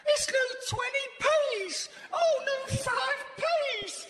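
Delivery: high, drawn-out squeal of delight; childlike excitement. Acted. Left alone, finding new coins, childlike excitement. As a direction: pitch it way up like a thrilled child. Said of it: "recede to the mentality of a child".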